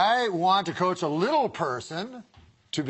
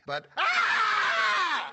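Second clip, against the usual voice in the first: laughing yelp. Real, not acted. Breaks off with a shout.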